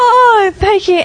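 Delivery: a high, loud, tearful cry of thanks; tearful high thanks to the lady with flowers; overwhelmed. Retold or imitated. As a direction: choke up with surprise and gratitude.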